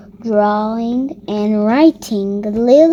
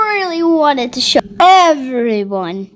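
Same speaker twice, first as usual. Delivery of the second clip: plain reading voice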